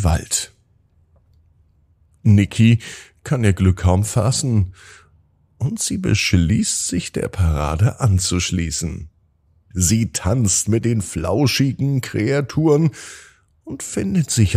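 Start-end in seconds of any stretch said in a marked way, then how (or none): none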